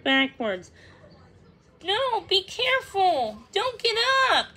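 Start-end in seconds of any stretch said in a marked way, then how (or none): 1.8-4.6 high-pitched urgent warning; cautioning. A narrator's reading. Thumbelina stops the hurt bird rising, cautioning urgently. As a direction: warn anxiously, pitch jumping up. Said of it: "cautioned Thumbelina".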